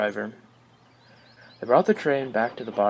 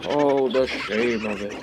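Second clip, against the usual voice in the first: slow, hammed-up lament; mock shame; 4 dB louder than usual. A narrator's reading. Proud engine lamenting his dirty coal trucks. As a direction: wail about the shame. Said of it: "he grumbled".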